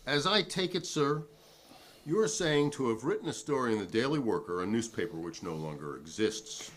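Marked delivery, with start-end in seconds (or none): none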